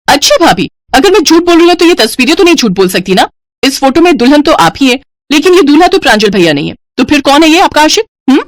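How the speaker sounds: high, needling sister-in-law; pointed, high-pitched interrogation; triumphant accusation. Acted. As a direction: corner her with the proof. Sister-in-law presents photo as proof.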